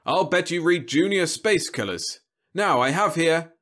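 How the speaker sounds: smooth TV-star patter; winning sales pitch. A narrator's reading. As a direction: win the customer over. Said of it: "Roby said winningly".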